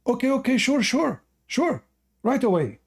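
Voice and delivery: high, rushed yes-yes of a wife; eager wife. Acted. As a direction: hurry to please him. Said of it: "she said".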